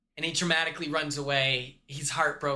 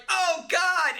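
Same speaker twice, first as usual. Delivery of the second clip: mock gasp; 11 semitones higher, 3 dB louder than usual. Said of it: "he's like".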